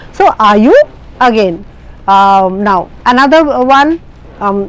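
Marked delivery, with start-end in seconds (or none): 0.4-0.8 YOU climbing sharply at the end; rising tag question. Shown as a demonstration. Teacher repeating the tag example, rising on YOU. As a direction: raise the pitch through YOU. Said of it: "The rising intonation".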